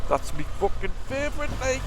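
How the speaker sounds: a hint of a Geordie lad cheering; light Geordie accent, playful. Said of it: "Geordie's the accent of the day".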